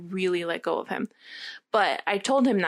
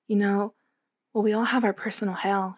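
usual speaking voice

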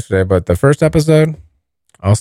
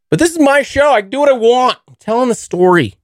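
high, childish put-on voice; bratty defiance; 12 semitones higher, faster than usual. Telling viewers it's his show, bratty high voice. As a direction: sass it back like a brat. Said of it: "defending his show playfully".

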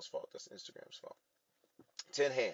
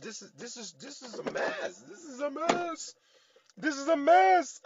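very high, stretched-out whine building louder; exasperated wail; 3 dB louder than usual. Retold or imitated. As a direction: wail it slowly, far up in pitch, at wit's end. Acting out frustrated complaint to God.

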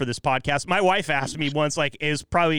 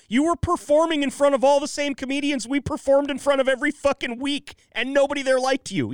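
higher, pushed indignant rant; heated rant; 9 semitones higher than usual. Real, not acted. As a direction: tear into him, indignant. Ranting about the rival.

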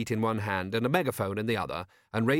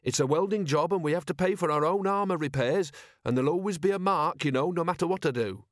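higher-pitched aggrieved grumbling; complaining; 6 semitones higher than usual. A narrator's reading. Constable moaning about armour repair costs, complaining. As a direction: grumble on with a raised, put-upon pitch. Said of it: "he complained".

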